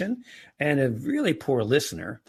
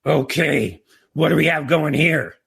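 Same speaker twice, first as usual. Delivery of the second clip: loud, forceful demand on walking in; confrontational reaction; 3 semitones higher, 7 dB louder than usual. Shown as a demonstration. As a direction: hit it hard, as an irritated parent would. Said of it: "if I walked in and went".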